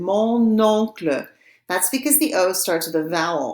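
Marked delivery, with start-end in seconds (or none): 0.0-1.3 slow "Mon oncle" with the N sounded into "oncle"; linking N. Shown as a demonstration. Teacher shows students the N before a vowel, slow liaison in "Mon oncle". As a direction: carry the N of "Mon" onto the vowel of "oncle", slowly. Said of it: "Notice how I pronounce that N".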